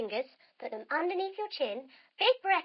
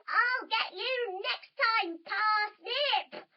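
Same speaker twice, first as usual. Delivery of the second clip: villain threat; 8 semitones higher, much slower than usual. Acted.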